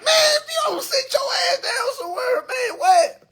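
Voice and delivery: squealing mock yell through laughter; mock yell to a plane passenger, broken by laughter; high-pitched laughing yell. Real, not acted. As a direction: crack up while yelling at her to sit down. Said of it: "I'm like".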